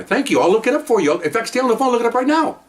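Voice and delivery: high-pitched, bright phone reply; high, exaggerated helpfulness; mock-eager thanks. Retold triumphant reply on phone.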